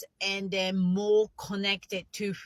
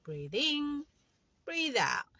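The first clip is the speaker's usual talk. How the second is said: gentle, softer breathe in, breathe out; calm meditation cue; soft breathing cue; 5 semitones higher, 4 dB quieter than usual. Shown as a demonstration. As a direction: guide the breath softly. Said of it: "You just say that in your mind".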